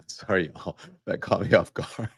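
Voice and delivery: giggling through a sorry, trying to stop; laughing apology. Real, not acted. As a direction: apologise while the laugh keeps breaking through. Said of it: "I don't mean to laugh".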